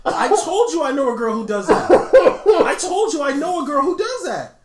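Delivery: high, loud, laughing exclamation; excited outburst. Real, not acted. Shout it with delight at being proved right.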